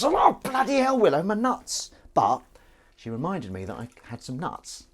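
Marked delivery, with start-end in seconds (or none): 0.0-1.9 high, loud yelp of protest; sudden high-pitched shout; mock pained outburst. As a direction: cry out in comic pain at the cat. Reenacting yelp as cat launches off.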